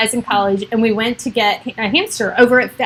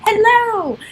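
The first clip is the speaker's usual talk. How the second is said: high, squealed hello, building louder; silly put-on voice; 9 dB louder, much slower than usual. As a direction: greet a viewer in a goofy shrieking voice. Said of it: "I don't know why I do that voice".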